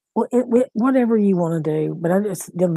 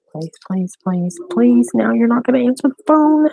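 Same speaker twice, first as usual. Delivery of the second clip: higher and louder, pleading then exasperated; rising panic; 5 semitones higher, 5 dB louder than usual. Real, not acted. Grandmother begging her daughter to pick up, panicking. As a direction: beg the phone to be answered, losing it. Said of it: "I'm fixing to start stressing out".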